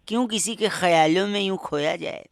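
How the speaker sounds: normal talking voice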